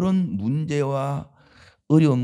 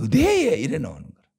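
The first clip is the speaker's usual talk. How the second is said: 경상도 사투리로 높고 크게; Gyeongsang dialect; 3 semitones higher than usual. Retold or imitated. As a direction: say "의대에" sharply up in Gyeongsang dialect. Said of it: "경상도 말로".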